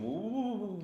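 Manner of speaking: high lightheaded woo; dizzy woo. Retold or imitated. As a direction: reel like the room is spinning. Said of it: "the room starts going".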